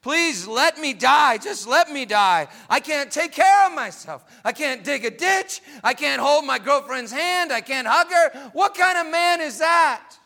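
high, loud, wailing prayer to be allowed to die; desperate crying plea. Retold or imitated. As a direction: cry out to God in anguish, pushing to a shout. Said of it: "He cried".

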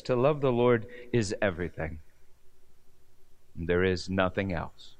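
low, hushed, understated sorrow; retold weeping testimony. Preacher voicing blind Alex's testimony, subdued weeping.

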